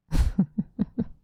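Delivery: light, rippling little laugh; remorseless chuckle. Acted. Vampire confessing she cared about nothing, light chuckle. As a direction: chuckle without a trace of remorse.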